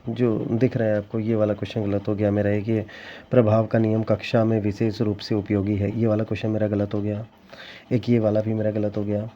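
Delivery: normal talking voice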